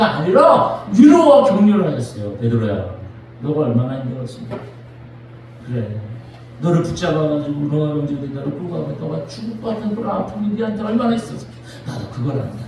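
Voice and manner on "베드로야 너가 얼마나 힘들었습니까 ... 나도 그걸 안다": low, soft and gentle; Jesus gently reassuring Peter, low and soft; tender comfort. Acted. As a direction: speak like consoling a broken friend.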